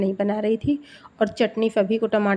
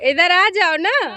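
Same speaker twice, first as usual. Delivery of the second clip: high, loud, drawn-out call; 8 semitones higher, 5 dB louder, slower than usual. Real, not acted. Calling the child to come away, high drawn-out call.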